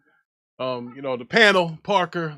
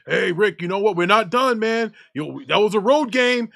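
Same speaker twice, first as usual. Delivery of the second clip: hint of a smug Cowboys fan, louder; lightly voiced coworker, a touch louder; mocking coworker; 4 semitones higher, 6 dB louder than usual. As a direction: mimic the coworker brushing off the loss. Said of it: "every time they come to my office".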